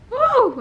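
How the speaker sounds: high loud woo; mocking whoop. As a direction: whoop like the jeering kids. Crowd laughing imitated.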